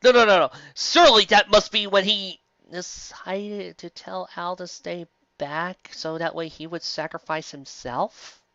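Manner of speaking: ordinary talk, nothing marked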